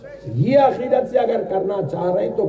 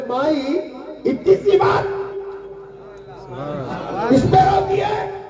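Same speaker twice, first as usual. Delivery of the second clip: astonished shout; 7 semitones higher, slower than usual. Acted. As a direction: shout out in amazement at something so small. Child saint astonished at her grief.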